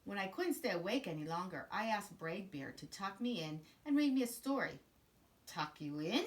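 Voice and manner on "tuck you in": ordinary talk, nothing marked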